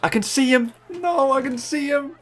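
high-pitched anguished no, no; high panicked despair. Real, not acted. Gamer panicking as the chaser closes in, high and anguished. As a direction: wail as the chaser comes into view.

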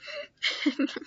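short high giggle; laugh. Real, not acted. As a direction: giggle softly, pitched high. Laughing about friend mocking channel.

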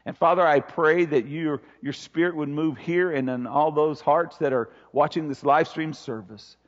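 low, hushed pastoral prayer; earnest prayer. Real, not acted. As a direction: pray softly and low, letting it sink toward the amen. Leads congregation in prayer.

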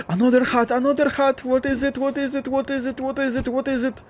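gasp, then high-pitched breathless chant; excited squeal. Real, not acted. Player spotting another hat in the game, excited squeal. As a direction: squeal it over and over, held very high.

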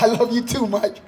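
lightly voiced reply from God; answering himself back. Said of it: "And I answer myself back".

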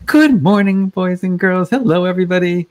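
high, upbeat forced-positive teacher greeting; cheery kindergarten teacher. Retold or imitated. Kindergarten teacher greeting her class, high and forced-cheery. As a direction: put on the relentlessly positive classroom voice. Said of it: "they gotta be positive all the time".

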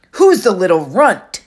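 loud, husky sneer; sneering bully. Acted. Clarice sizes up the new kid, loud husky scoff. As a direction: look down on him and scoff.

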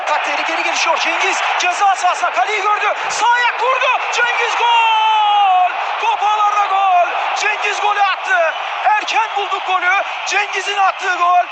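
goal call at the top of the voice; screaming football commentator. Real, not acted. Turkish goal call, commentator erupts.

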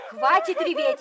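stern, high scolding shout; angry shout. Acted. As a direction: snap at her to stop crying. Scolding a crying girl.

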